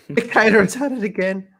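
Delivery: high, loud mock outrage; mocking jab. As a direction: sneer at the dictator line. Mocking the premier.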